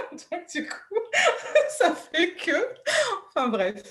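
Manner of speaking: embarrassed laugh through the words. Real, not acted. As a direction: laugh it off after choking. Laughs after choking on water.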